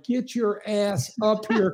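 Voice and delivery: hammed-up order to hurry over; mock scolding. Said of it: "I'm like".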